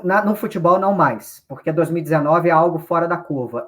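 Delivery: ordinary talk, nothing marked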